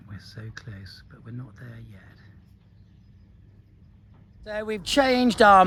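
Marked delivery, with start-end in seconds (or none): 0.1-2.0 hushed whisper while hiding; whispering from behind cover. Real, not acted. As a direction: keep it to a breath so the bull won't hear. Hiding from the bull.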